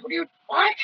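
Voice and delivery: high, baffled retort; mock bewildered what; squeaky retold disbelief; confused protest. Confused reply to weight insult.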